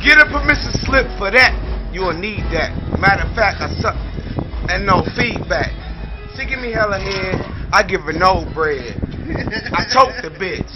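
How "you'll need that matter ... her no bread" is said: ordinary talk, nothing marked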